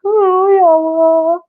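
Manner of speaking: real tearful voice, pitched high; sobbing between phrases, struggling to go on; crying. Real, not acted. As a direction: speak through tears of overwhelmed gratitude. Overcome recalling the gift, crying.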